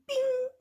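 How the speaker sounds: high, loud ringing "bing"; ping it out suddenly, high; snapping "bing". A narrator's reading. Storyteller as a rope strand breaks, sudden bing.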